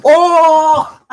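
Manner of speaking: very high, loud, long "oh"; crowd-goes-wild holler at a burn; hyped reaction. Real, not acted. Reacting to a roast.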